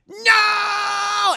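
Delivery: very high, loud howl of defeat; anguished scream. Retold or imitated. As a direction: scream it, cornered and doomed. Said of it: "And Dio's like".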